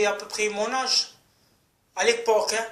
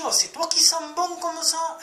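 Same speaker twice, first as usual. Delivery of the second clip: high mock woman's voice; mimicking the woman; 8 semitones higher than usual. Mocking the woman he met with a high voice.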